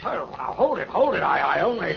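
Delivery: high, startled "hold it"; nervous man flinching from the detective; alarmed protest. Acted. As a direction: back off in a sudden panic. Hobson startled as Spade moves.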